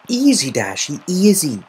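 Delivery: loud, urgent calming; calm her down firmly; settling an upset friend; soothing. A narrator's reading. Gilda calming Rainbow.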